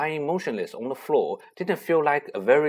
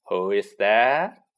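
slow suspicious call; 3 semitones lower, 4 dB louder, slower than usual. Acted. Poltergeist Peeves calls out.

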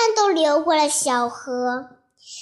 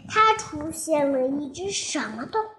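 plain reading voice

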